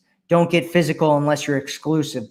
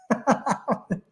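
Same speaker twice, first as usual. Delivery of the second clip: amused laugh; 7 semitones higher, 7 dB quieter than usual. Real, not acted. Laughs at the question.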